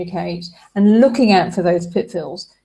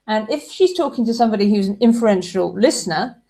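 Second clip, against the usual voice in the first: usual speaking voice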